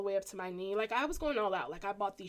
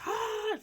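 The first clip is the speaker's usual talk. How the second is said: mock scream; 13 semitones higher, 5 dB louder than usual. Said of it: "freaking out".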